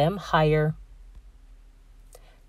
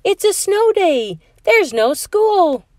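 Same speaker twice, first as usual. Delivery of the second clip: put-on high, slow, excited little boy; eager boy voice; 13 semitones higher, 5 dB louder than usual. A narrator's reading. Robbie telling his sleeping sister, excited high voice. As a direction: pour the news into her ear. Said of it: "said into Heather's sleeping ear".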